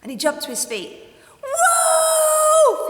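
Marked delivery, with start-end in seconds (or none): 1.4-2.9 high, loud cry of wolf; shouting. Acted. Boy crying wolf to the village, loud shout. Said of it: "he shouted".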